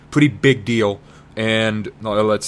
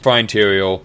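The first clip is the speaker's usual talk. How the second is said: ordinary talk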